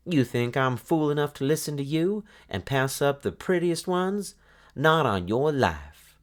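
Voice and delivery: high, scornful jeer; a greedy girl mocking the talking eggs; scoffing. A narrator's reading. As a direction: sneer at the foolish eggs.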